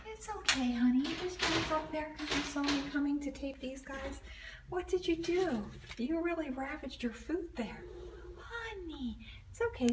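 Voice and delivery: high, sing-song coo to a shy cat; gentle high pet voice. Real, not acted. Cooing to the boarded cat.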